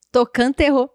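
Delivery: ordinary talk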